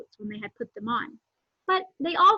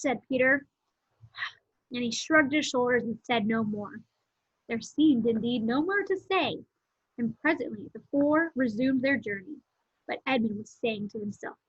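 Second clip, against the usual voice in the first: ordinary delivery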